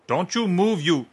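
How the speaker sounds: high, loud menacing yell; threatening shout. Acted. Gunman threatens Dan.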